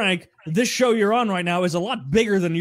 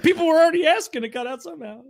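laughing; 8 semitones higher, faster than usual. Real, not acted. Still laughing, defending himself.

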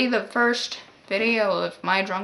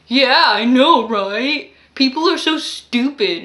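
put-on drunk man on the phone; 3 semitones higher, 6 dB louder, slower than usual.

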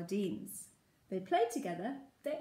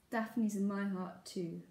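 plain reading voice

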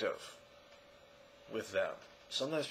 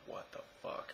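whispered disbelief; 18 dB quieter than usual. Retold or imitated. Imagined viewer reacting.

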